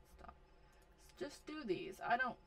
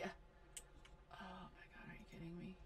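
shocked whisper; 5 semitones lower, 22 dB quieter than usual. Real, not acted. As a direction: breathe it out in disbelief. Shocked aside to herself.

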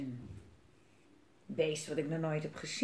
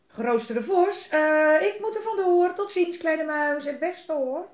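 high, loud panicky fox blurting excuses; startled fox scrambling to get away; frightened fox; 8 semitones higher, 7 dB louder than usual. Acted. Fox panics and hurries off from the mouse, high and loud.

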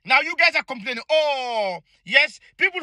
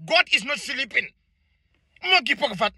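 ordinary delivery

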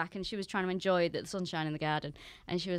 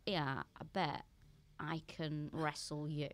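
slow, softer imitation of a drunken dare; tipsy challenge, retold; 4 semitones lower, 7 dB quieter, slower than usual. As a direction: draw the dare out, low-key and deliberate. Retelling her drunken challenge to boyfriend.

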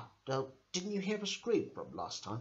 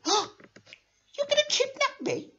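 high, slow gasping disbelief; shocked gasp; 5 dB louder, much slower than usual. Acted. Captive toy hearing the kidnap plan, shocked. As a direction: gasp it out, horrified.

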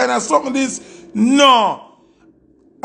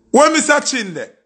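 usual speaking voice

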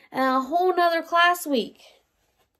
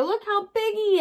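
amazed; 8 semitones higher than usual. Acted. Amazed at the big caterpillar.

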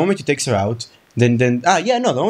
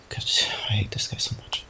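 unvoiced, hissed under the breath; whispered hate; 7 semitones lower, 11 dB quieter than usual. Real, not acted. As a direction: whisper your loathing. Disgust at character.

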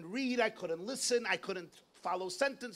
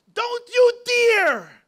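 much higher, louder, slow, each word hammered; a fierce shouted warning to the family; furious warning; 15 dB louder, much slower than usual. Retold or imitated. Grandfather defending his grandson before the family, furious slow shout.

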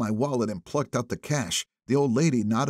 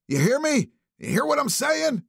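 shouting; 3 dB louder than usual. A narrator's reading. Drunk man shouting.

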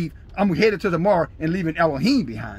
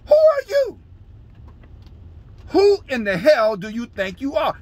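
high, loud, indignant cry that falls back; outraged challenge; 8 semitones higher, 4 dB louder than usual. Acted. Preacher challenges the congregation in James's words, with an indignant loud cry. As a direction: hurl the question at the congregation.

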